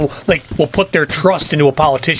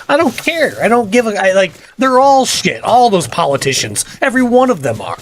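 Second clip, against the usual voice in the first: higher, heated rant about politicians; fired up; 7 semitones higher than usual. Real, not acted. Streamer ranting to chat about politicians, fired up. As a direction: let the anger spill over. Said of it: "Sorry, I got fired up on that one".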